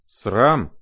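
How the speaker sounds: loud one-word rebuke; indignant scolding. A narrator's reading. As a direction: exclaim it as an indignant mother. Mother scolds her daughters. (возмущённый возглас)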